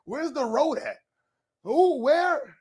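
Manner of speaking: high, squeaky baffled questions. Retold or imitated. Streamer mocking his confusion at the video, high baffled squeak. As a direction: act totally bewildered, voice way up. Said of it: "I'm like".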